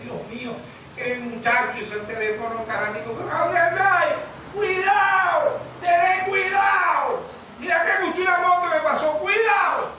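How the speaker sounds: a panicked mother calling after her son; mother's frightened plea. Retold or imitated.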